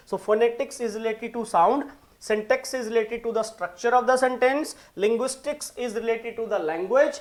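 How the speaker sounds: slow dictation. Acted. Teacher dictating the answer key, slow and emphatic. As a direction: spell it out for the class to note down.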